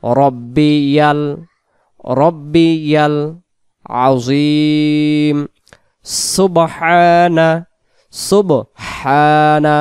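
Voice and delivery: very slow, drawn-out Arabic recitation; slow recitation demo. Shown as a demonstration. Imam demonstrating correct recitation to viewers, slow and drawn out. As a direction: recite each word stretched and melodic for the class. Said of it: "তাহলে আমরা একসাথে বলবো রব্বিয়াল".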